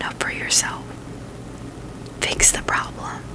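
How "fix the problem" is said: normal talking voice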